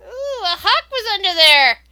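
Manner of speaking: high, loud amazed exclaim; surprised drunk kobold. Acted. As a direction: gasp it out like a startled drunk. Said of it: "Some of the drunk kobolds exclaim".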